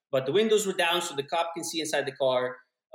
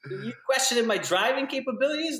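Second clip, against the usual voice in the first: higher, mock-indignant drunk challenge; puffed-up offended bravado; retelling his drunk self, mock offended; 8 semitones higher than usual.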